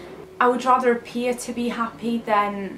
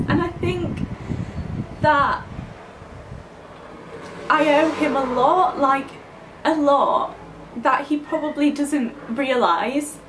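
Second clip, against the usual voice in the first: teary; 3 semitones higher than usual. Real, not acted. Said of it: "Don't cry again".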